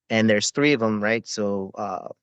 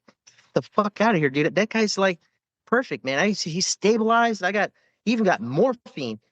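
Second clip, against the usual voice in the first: higher disbelieving protest; incredulous protest; 8 semitones higher than usual. Retold or imitated. Medic arguing with the Army medic, incredulous higher voice. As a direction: insist he's fine, pitched up in disbelief. Disbelief over casualty count.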